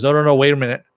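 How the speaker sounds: lightly acted urgent halt; urgent interruption. Frenchie stopping the detonation, mildly urgent.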